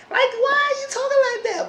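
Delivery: shrill falsetto jab at his voice; squeaky mock retort. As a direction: squeal it like a mocking girlfriend. [name] mocks Sean's voice.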